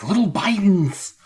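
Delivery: lingering mock Biden accent on little Bidens. Host repeating the joke in a mock Biden accent. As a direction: keep a trace of the Biden impression. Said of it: "that accent".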